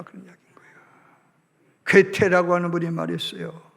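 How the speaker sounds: normal talking voice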